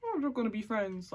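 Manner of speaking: lightly voiced naive thought; a touch of sing-song innocence; mocking naive self. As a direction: mimic your own foolish optimism. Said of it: "obviously I'm stupid. I let them".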